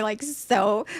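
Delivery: ordinary talk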